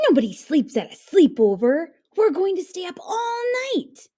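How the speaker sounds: high, eager and fast; thrilled child insisting; excited. A narrator's reading. Jim excited about sleepover.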